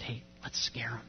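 whispered prank plan; conspiratorial whisper. Retold or imitated. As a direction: plot it in a sneaky whisper. Boys plotting a prank at night.

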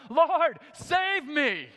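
loud, high cry for help; panicked shout for rescue. Retold or imitated. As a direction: yell it out like a sinking man. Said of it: "and he cried out".

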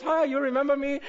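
high, bright mock girl voice; teasing impression of a young woman; imitating a girl. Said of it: "a little Chinese girl comes up and goes".

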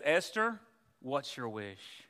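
a low, soft kingly voice; the king asking gently, slow and hushed; tender king offering Esther a wish; voicing the king. Preacher voicing the loving king.

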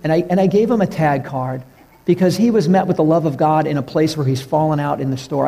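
usual speaking voice

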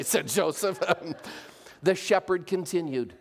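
plain reading voice

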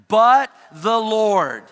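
loud, slow, emphatic call. Shown as a demonstration. Leading the church in a loud slow response. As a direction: proclaim it slowly and loudly. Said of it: "let's say these first three words of verse four together, nice and loud".

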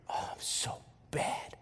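whispered, remorseful retold aside; guilty whisper. Retells his own guilt.

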